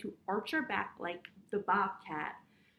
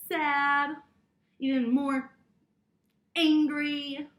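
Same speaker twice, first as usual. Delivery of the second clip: 1 angry mouse; 2 semitones higher, 8 dB louder, slower than usual. A narrator's reading. Story mouse gets angrier.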